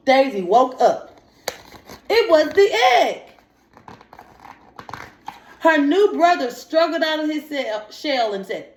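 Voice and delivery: lively read-aloud, pitch lifting on the story lines; storybook reading. Acted. Teacher reading a picture book aloud to young students. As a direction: read the story to the children with a little life.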